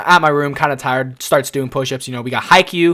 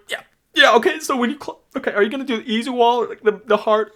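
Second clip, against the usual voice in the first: much higher, fast chirpy patter; forced cheery rush; 8 semitones higher, faster than usual. Retold or imitated. Attendant harnessing a kid while holding back tears, forced cheer. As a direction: babble brightly to hide the crying.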